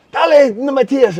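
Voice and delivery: high, loud Spanish cheer; yelling. Retold or imitated. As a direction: imitate parents yelling to their kid. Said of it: "the families are yelling".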